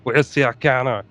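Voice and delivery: lightly voiced flat robotic killer; a hint of Terminator asking for Sarah. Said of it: "He goes".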